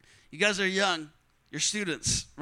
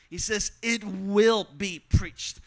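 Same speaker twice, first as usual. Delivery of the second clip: slow, weighty, leaning on "will" over "should" and "might"; slow and weighty, every word of "it will be preached" hit; stress on will; slower than usual. Shown as a demonstration. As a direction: land hard on "will be preached". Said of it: "he doesn't say the gospel should be preached".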